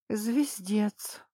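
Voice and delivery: unvoiced, despairing whisper; whispered groan. A narrator's reading. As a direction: breathe it out in dismay. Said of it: "в сердцах простонала я".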